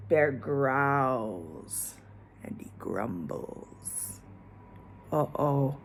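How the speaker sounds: sinking to a low whisper. A narrator's reading. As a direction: let it fade into a hush. Sinking to a hush.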